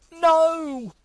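sudden high shout of alarm; horrified cry. A narrator's reading. Gus crying out as the pen strikes Fenton, horrified shout. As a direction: cry out in horror at the hit. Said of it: "Gus cried".

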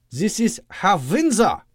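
put-on high, slow thick German accent; thick Doctor Loeb accent. A narrator's reading. Doctor introducing Windsor to his mother, German accent. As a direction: present the guest in heavy German accent. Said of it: "his Doctor Loeb performance".

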